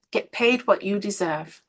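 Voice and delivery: usual speaking voice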